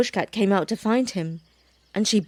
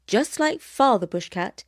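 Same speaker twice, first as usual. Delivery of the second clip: ordinary delivery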